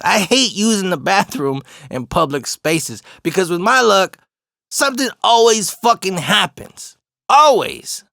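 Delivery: exasperated rant. Real, not acted. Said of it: "ranting about public bathrooms".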